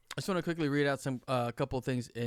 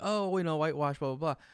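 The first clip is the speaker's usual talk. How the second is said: higher, dismissive imitation of complainers; put-on protest, waved away; mock complaining; 4 semitones higher, 5 dB louder than usual. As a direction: parody a grumbling crowd, pitched up. Mimics people complaining about casting.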